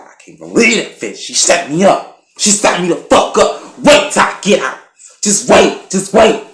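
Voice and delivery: loud, high, furious mock rant; angry impression. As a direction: rage like a man out for revenge. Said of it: "he's just so angry".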